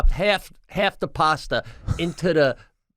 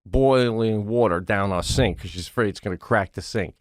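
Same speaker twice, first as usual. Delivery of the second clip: ordinary talk, nothing marked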